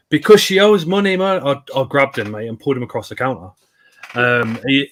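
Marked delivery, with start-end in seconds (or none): 0.1-1.4 louder and higher defiant retort; mock shopkeeper shouting back at the grandson; retold shopkeeper. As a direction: push back hard, a little raised. Shopkeeper quoted in a confrontation.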